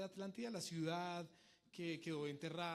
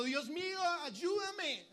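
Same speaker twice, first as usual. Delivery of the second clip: loud, high cry for help; acted shout to God; 10 semitones higher, 9 dB louder, much slower than usual. Child of God crying out for help, high loud shout. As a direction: cry out pleadingly, drawn out. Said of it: "que le está gritando".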